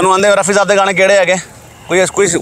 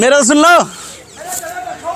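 drawn-out high plea; 8 semitones higher, much slower than usual. Acted. Pleading as guest leaves.

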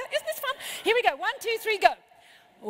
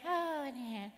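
soft, deflated mumble of agreement; mock a worn-out grown-up's weak reply; pathetic, unimpressed adult; 7 dB quieter than usual. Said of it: "pathetic old human me is going".

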